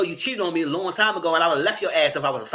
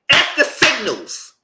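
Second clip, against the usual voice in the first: high, loud, slow demand; fed-up yell at people who want mind reading; exasperated shout; 11 semitones higher, 9 dB louder, much slower than usual. Real, not acted. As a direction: bark it out, fed up. Ranting at partner.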